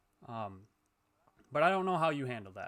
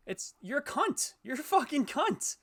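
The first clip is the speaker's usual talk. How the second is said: pitched far higher, slow angry yell; yelling insult; 10 semitones higher, slower than usual. Real, not acted. Streamer yelling an insult at a cheater, furious. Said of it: "I'm sorry for yelling".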